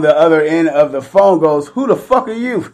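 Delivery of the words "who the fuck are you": high-pitched, indignant retold challenge; mock indignant demand. Imitating a guy answering the wrong phone, mock indignant. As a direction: act out an affronted stranger, pitched up. Angry stranger on the phone, quoted.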